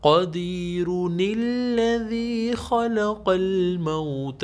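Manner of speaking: قدیر joined to الذی through the نون, slow and chanted; joined reading. Shown as a demonstration. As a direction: carry the نون into الذی without stopping. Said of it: "اگر stop نہیں کریں گے". (بغیر رکے نون ملا کر)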